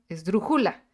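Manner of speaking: "esdrújula" misstressed as es-dru-JU-la; stress on penultimate syllable. Shown as a demonstration. Teacher showing penultimate stress, "esdrújula" said es-dru-JU-la. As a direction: move the weight in "esdrújula" onto JU. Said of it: "en la penúltima".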